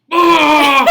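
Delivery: loud, high mock groan of agony; hammed-up bellow of suffering; agonized groan. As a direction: let out a big strained "oh". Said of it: "And he's like".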